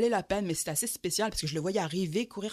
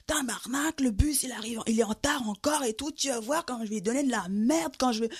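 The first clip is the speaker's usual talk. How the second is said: higher, fuming rant about a late bus; a cold man cursing the bus to himself; grumbling angry man; 5 semitones higher than usual. Retold or imitated. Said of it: "il s'énervait tout seul".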